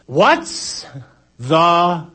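comic drawn-out call, word by word; yelling back. Acted. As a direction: call back across the storm, spacing every word. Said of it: "yelling back, something like this".

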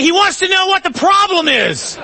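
high, loud relayed message; shouting over wind. Acted. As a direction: yell it across to someone deafened by a storm. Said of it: "or we're never gonna hear him".

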